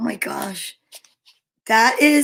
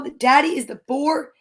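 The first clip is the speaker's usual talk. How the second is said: ordinary delivery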